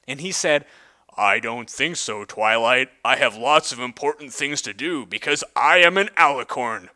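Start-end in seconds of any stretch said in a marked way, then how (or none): 1.2-6.9 charming alicorn voice. Acted. Alicorn turns Twilight down.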